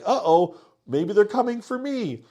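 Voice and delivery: imitating Tara's creeping panic; mock nervous Tara. Tara senses danger.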